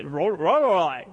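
Lorelei started with an R sound, Ro-, then corrected; imitating mispronunciation. Japanese mother-in-law struggling with her granddaughter's name, Lorelei started as Ro-. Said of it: "She just can't say".

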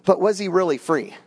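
ordinary talk, nothing marked

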